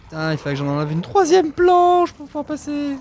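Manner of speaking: frantic shrieking hurry; being chased. Real, not acted. Chased, panicking. (cris de panique poursuivi)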